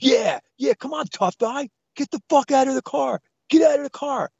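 high, goading tough-guy challenge; mock yelling. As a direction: imitate the husband daring him to fight. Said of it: "he's yelling at me".

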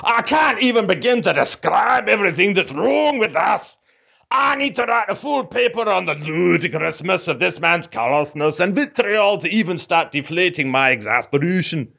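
put-on a Scottish accent straining to pass as American English; Scottish accent faked into American English; Scot faking American. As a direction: play a Scotsman feigning an American accent, a bit pompous. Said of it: "a Scotsman trying to feign an American accent".

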